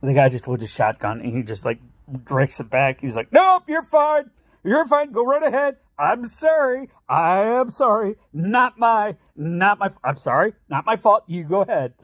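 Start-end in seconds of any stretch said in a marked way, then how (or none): 3.3-5.8 a driver backing off from an armed man, in mock terror; mock scared. Scared driver facing shotgun. 6.0-11.9 mock frantic apology. Driver apologizing to gunman.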